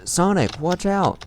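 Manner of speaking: high shouted warning to Sonic; yelling warning. Acted. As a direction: call out from above, urgent. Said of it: "Tails yelled from above me".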